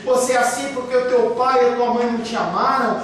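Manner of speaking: normal talking voice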